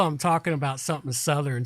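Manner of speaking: normal talking voice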